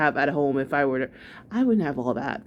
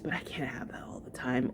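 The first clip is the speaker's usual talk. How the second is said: whispering; 5 semitones lower, 11 dB quieter than usual. Real, not acted. Whispered aside.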